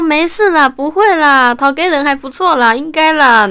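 imitating herself comforting a friend; reassuring. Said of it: "然后我就说".

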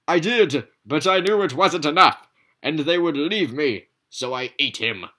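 high, loud dramatic confession; melodramatic pizza. Acted. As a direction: play it as a tragic lover.

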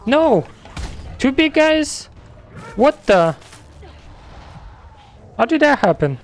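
high panicked yelps; startled, voice jumping up; panicking gamer. Real, not acted. Swarmed by big enemies in game.

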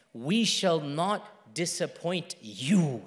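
slow, low, soft reassurance; quiet, lingering pledge; gentle promise. Retold or imitated. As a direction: comfort with measured, separated words. Same promise, gentle then proclaimed.

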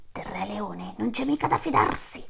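hushed, voiceless whisper of distrust; whispered warning. Acted. Herd leader whispers doubts about the lion king, hushed. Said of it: "disse il capobranco degli antilopi".

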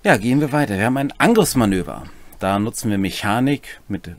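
normal talking voice

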